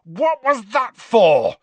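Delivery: loud, slow, high bellow; outraged shouted question. A narrator's reading. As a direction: bellow it indignantly, each word drawn out. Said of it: "Harry bellowed".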